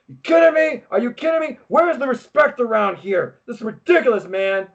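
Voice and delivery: high, indignant "are you kidding me"; mock furious. Undisputed Era complaining about Regal, mock furious. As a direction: rant like an offended heel. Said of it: "the Undisputed Era is just furious".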